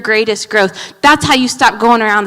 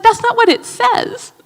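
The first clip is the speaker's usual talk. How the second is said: exasperated husband; 11 semitones higher than usual. Retold or imitated. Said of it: "He's like".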